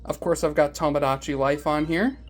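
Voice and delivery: ordinary talk, nothing marked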